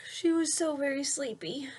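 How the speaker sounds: put-on lilting rhyme read to a child; read-aloud storytelling. A narrator's reading. Parent reading a rhyming bedtime book aloud to a child. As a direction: read the verse with a gentle bounce.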